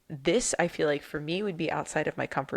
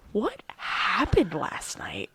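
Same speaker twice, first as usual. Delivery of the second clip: put-on New York accent; 3 semitones higher, much slower than usual. Friend joking about waking up changed, in a New York accent. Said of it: "start sounding like that".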